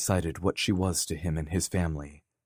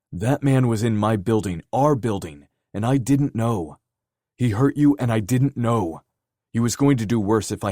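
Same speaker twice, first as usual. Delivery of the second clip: loud, higher, furious self-blame; angry shouting; 5 semitones higher, 6 dB louder than usual. Acted. Dare raging to Kenzie that he missed it, loud furious voice. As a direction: hammer each phrase with rage.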